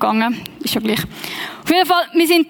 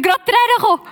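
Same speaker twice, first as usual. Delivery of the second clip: high-pitched repeat; 9 semitones higher, much faster than usual. Real, not acted. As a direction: repeat it way up high after a laugh. Repeats line high after laughing. (mit hoher Stimme wiederholt)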